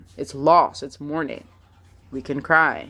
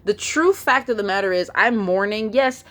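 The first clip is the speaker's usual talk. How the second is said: ordinary delivery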